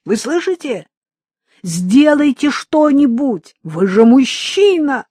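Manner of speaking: high and loud, frantic reproach; desperate shout. Acted. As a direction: hurl it at him in anguish. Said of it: "кричала она".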